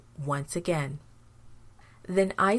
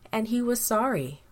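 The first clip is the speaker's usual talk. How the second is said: usual speaking voice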